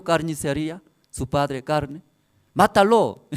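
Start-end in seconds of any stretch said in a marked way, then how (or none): 2.5-3.3 niño jugando al carnicero. Retold or imitated. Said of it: "su hijo también igual".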